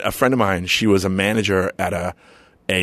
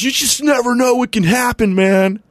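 much higher, put-on fighter voice; imitating Chemo; 14 semitones higher than usual. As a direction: mimic the big fighter's surprisingly high voice. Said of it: "He's, like, talking like that".

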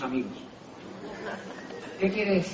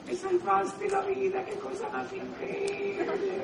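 much higher, slow and soft gushing voice; grateful prospect; 7 dB quieter, slower than usual. Retold or imitated. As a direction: gush with thanks in a falsetto. (voz agudísima y agradecida)

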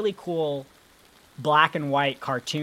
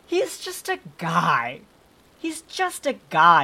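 louder, drawn-out plea, rising higher; exasperated protest; 3 semitones higher, 6 dB louder, slower than usual. Real, not acted. Podcaster defending a movie henchman to co-host, exasperated. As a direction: protest it slowly, pitching up with indignation.

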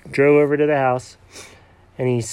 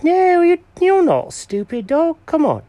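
high, eager cat character voice; proud cat showing Norman the bed, high and eager; Jenkins the cat voice. Acted. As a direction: play the cat showing off his old find. Jenkins reassuring the dog in voice.

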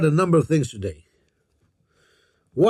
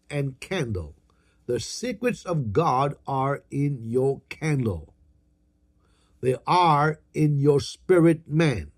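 usual speaking voice

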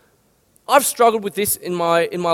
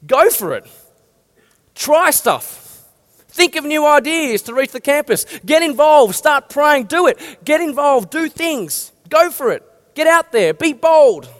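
higher and louder, rallying the crowd; rousing exhortation; 5 semitones higher, 3 dB louder, slower than usual. Shown as a demonstration. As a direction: fire them up, each order punched. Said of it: "rallying call to action".

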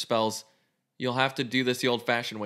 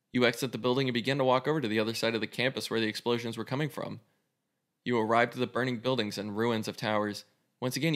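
ordinary talk

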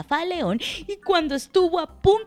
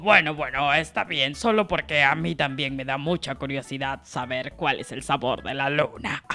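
much lower, far lower storybook lion; deep, grudging lion voice; 11 semitones lower than usual. Acted. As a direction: growl it out as a big friendly lion. Lion character speaks.

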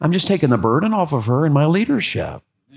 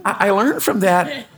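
laughing; 11 semitones higher than usual. Real, not acted. Laughing while resuming point.

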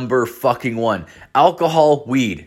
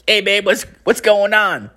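high put-on boyfriend voice; boyfriend on phone; 10 semitones higher than usual. Guy picking up his girlfriend's call, high caricature. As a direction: answer her in a raised, caricatured voice. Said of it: "picks it up and he's like".

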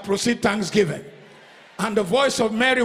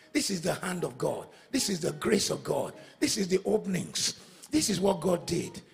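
soft humble preaching; 4 semitones lower, 8 dB quieter than usual. Acted. Preacher softly reminding congregation of God's goodness. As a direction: bring them down to humility.

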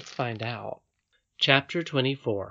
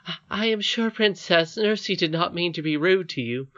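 high, hesitant servant voice; smooth things over nervously; placating housekeeper; 6 semitones higher, 3 dB louder than usual. Acted. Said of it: "afraid of the nurse and sought to patch matters up".

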